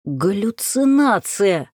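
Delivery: медленный хриплый выкрик; прохрипеть слово, растягивая. Acted. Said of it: "прохрипел Ярослав". (хрипло и медленно)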